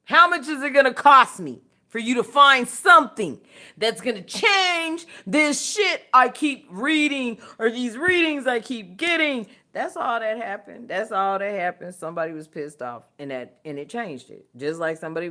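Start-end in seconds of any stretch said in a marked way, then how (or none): 0.0-9.5 mock demanding rich caller. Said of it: "They must have called".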